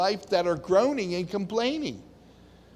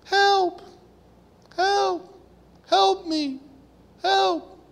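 high desperate calls for help; acted pleas for help, pitched high; cries for help; 12 semitones higher, much slower than usual. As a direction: cry out like someone drowning. Acted cry of a groaning soul.

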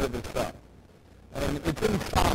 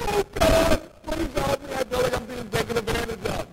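mock self-pitying cry; 8 semitones higher than usual. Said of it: "we need to cry out".

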